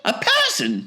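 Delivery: high falsetto exclamation; Wonka's astonished cry. A narrator's reading. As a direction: cry it out in piping disbelief. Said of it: "cried Mister Wonka".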